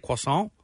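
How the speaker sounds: croissant as "kwah-sahn", rising at the end; pretentious French croissant. Shown as a demonstration. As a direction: say croissant French-style, as if showing off. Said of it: "it's a very pretentious sounding language".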